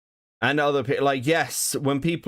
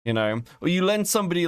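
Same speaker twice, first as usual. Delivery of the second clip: normal talking voice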